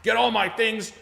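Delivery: high, loud mock demand; bossy customer. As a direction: order him around, slowly, hammed-up. Acting out demanding customers bossing Brian.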